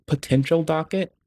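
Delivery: ordinary talk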